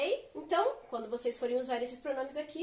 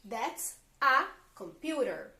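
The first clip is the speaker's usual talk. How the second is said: slow, clear model sentence for students; slow teaching model; 5 dB louder, much slower than usual. Shown as a demonstration. Teacher modelling the English sentence slowly for the class.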